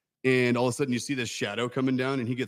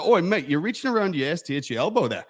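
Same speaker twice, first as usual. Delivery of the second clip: loud, higher Australian bloke; Australian accent; 6 semitones higher, 5 dB louder than usual. Retold or imitated. Quoting a funny coworker, in a loud Australian accent. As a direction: imitate a cheeky Aussie, loud and pitched up. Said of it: "no, he was Australian".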